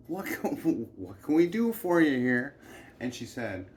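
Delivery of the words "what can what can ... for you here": louder, higher, laughing through it; amused fake service; mocking laughing offer. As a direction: laugh while humoring a brat. Men mock the spoiled girl.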